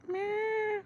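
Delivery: thin high meow; soft, high-pitched little mew; playful meow. Pretend. Streamer playing with a Papa Smurf figure, playful high meow.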